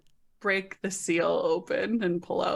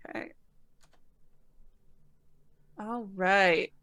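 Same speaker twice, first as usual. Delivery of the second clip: normal talking voice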